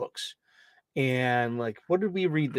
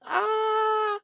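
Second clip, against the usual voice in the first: high laugh. Real, not acted. Laugh after joke.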